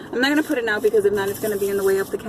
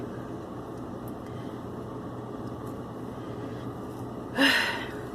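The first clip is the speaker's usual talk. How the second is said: long exhale; 4 semitones higher, 11 dB quieter than usual. Real, not acted. Exhale after rushing, out of breath.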